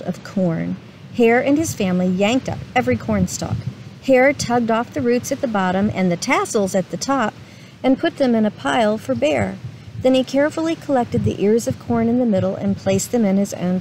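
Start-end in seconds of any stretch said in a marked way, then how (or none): none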